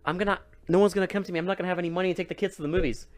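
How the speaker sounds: high, fast panicked ranting; frantic rant. Retold or imitated. Mom ranting at the cops, high and frantic.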